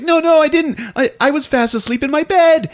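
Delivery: high, loud whiny protest; flustered denial. Retold or imitated. Ralph denying it to the parents, high panicky squeak. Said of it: "He's like".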